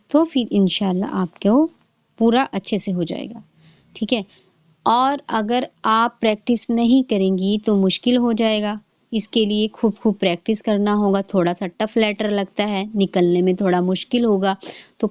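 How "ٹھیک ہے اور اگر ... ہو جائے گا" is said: ordinary talk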